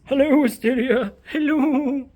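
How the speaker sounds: high, sweet greeting to a cat; Willow voice. Pretend. Greeting her kitten Asteria in a high Willow voice. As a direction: coo hello up high. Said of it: "Why are you Willow voicing this".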